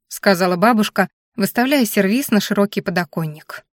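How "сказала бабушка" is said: plain reading voice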